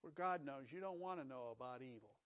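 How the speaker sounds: gentle warning. Acted. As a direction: ease her away from it tenderly. God gently warning Eve.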